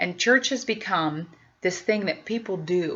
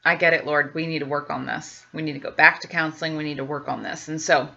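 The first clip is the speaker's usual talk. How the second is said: ordinary talk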